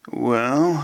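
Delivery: lazily drawn-out "Well"; drawled. A narrator's reading. Author answering a listener's question, drawled. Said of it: "I drawled".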